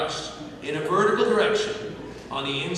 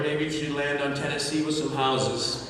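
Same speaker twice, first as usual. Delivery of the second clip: normal talking voice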